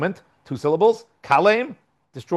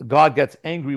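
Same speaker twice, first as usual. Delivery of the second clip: ordinary talk, nothing marked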